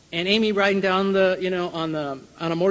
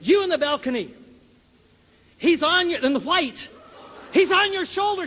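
calling out; 9 semitones higher than usual. Retold or imitated. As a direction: call up to the balcony. Healer calls out to balcony.

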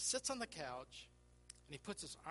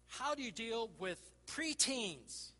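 slow, raised, loud rhetorical question; emphatic slow question; 6 semitones higher, 7 dB louder, slower than usual. Real, not acted. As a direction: lean hard into the question. Preacher poses a topic question.

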